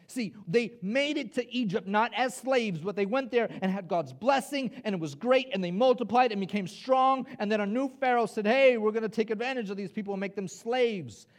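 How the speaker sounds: loud, high, driving storytelling; forceful preaching. Acted. Preacher telling how Israel became slaves, loud and driven. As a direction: pile up the story with rising urgency.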